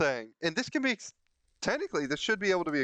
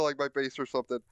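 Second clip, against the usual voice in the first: usual speaking voice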